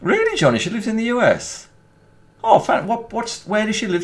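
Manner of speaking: high-pitched, amazed questioning; delighted surprise. Real, not acted. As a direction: react with eager astonishment. Surprised at viewer's news.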